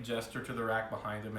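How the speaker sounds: ordinary talk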